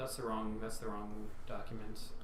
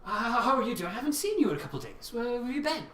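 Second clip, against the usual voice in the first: high posh British barkeep, a bit fast; 11 semitones higher, 3 dB louder than usual. Acted. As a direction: fuss over him like a posh British host. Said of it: "very kind of British and posh".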